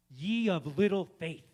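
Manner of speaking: drawn-out loving rebuke; gently chiding Jesus. Retold or imitated. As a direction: scold the sinking disciple slowly. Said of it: "And Jesus is like".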